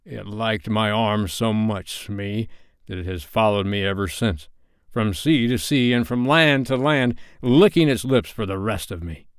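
plain reading voice

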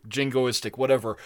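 ordinary talk

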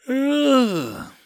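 high, stretched yawn sound; yawning groan. Acted. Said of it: "Gotha yawned".

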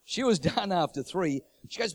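ordinary delivery

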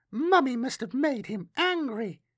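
put-on high-pitched child voice; small child explaining, pitched well up; little girl. A narrator's reading. Girl fixes her gaze on Matthias, high child voice.

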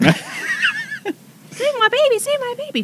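very high, drawn-out plea; mock plea. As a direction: squeal it in a tiny high voice as if begging for rescue. Acting out someone begging for baby.

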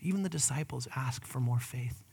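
usual speaking voice

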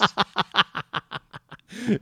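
a high-pitched burst of laughter. Real, not acted. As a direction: laugh it off at his own bad attempt. Laughs at his own accent.

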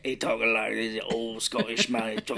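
put-on a light Scottish accent for an old man. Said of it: "He's got, like, a really deep voice".

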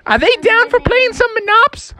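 very high, squeaky youngster voice; little wolf pups. Acted. Wolf children ask Dad about frogs, eager squeak. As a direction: pipe up eagerly to your father.

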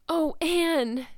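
high breathless gasp of relief; high, tearful cry of relief. A narrator's reading. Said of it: "gasped Diana".

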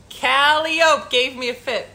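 "Calliope" slowly as KAL-ee-ope; wrong pronunciation. Real, not acted. Host struggling to read aloud, "Calliope" mispronounced KAL-ee-ope. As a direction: say "Calliope" to rhyme with rope, drawn out. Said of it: "I'm not saying it right".

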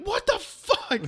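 very high mock shriek; horrified outburst. Two visitors reacting to the sacrifice, high-pitched horrified outburst. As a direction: squeal it high in exaggerated outrage.

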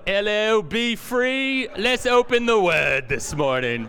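badly faked accent, high and loud; Chris Burrows. Pastor mimics the intern he follows, hammed-up bad accent. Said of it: "doing an accent is absolutely not one of them".

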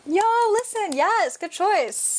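high, thrilled burst of enthusiasm; excited. Real, not acted. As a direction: jump in with delighted approval. Excited agreement about Richard III.

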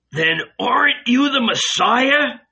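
high, distraught challenge; shouting. Acted. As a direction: cry out the question in dismay. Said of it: "Don't shout, Judas".

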